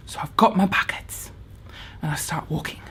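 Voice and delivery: breathy hushed narration, trying not to be heard; storyteller's whisper. Retold or imitated. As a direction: whisper it, sneaking past sleeping horses. Sneaking through paddock, whispering.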